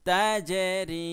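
তাজরি drawn out very slowly and loud, জ jolted; তাজরি intoned slowly with a bounce on জ; qalqala demo. Shown as a demonstration. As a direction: recite তাজরি with a push on জ. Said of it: "ধাক্কা দিয়ে".

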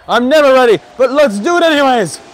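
loud, high burst of reckless excitement; excited yell. Real, not acted. Player not ready but going anyway, excited yell. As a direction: shout it out, psyching yourself up. Hyped up before the jump.

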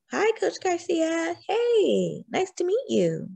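high, sing-song schoolkids' hello; students greeting. A narrator's reading. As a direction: greet the new coach in a high child's voice. Students greet the new coach.

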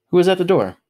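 high, loud prying question; nosy friend. A narrator's reading. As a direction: pipe up curiously as the pushy buddy.

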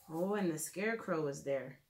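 ordinary talk

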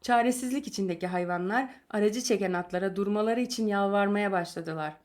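usual speaking voice

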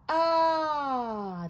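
high, loud "ahh" sliding slowly down; long open "ah". Acted. Giant sticks out tongue, says ah.

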